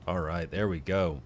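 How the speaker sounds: usual speaking voice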